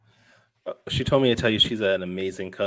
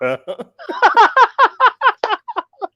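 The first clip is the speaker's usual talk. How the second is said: loud high laugh; 9 dB louder than usual. Real, not acted. Cracks up at Star Core.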